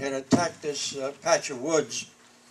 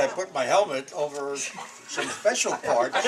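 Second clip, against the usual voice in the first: normal talking voice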